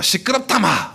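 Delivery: gruff Gyeongsang "시끄럽다, 마!" with a high rising 시끄럽다; mock Gyeongsang brush-off. Preacher mimics a Gyeongsang husband silencing his wife. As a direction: bark it off like a blunt Gyeongsang husband. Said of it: "특히 경상도 분들".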